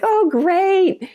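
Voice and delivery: a high mock-dismayed "oh great"; imitating Gloria. Gloria reacting to being mixed up, high and wry. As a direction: play the friend's wry dismay.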